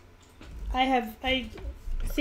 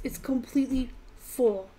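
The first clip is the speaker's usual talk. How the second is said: whispering. Real, not acted. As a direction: whisper it like a secret. Excited aside about full box.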